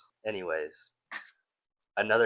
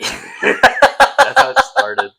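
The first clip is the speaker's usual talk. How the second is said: laughing; 12 dB louder, much slower than usual. Real, not acted. Laughing at the joke.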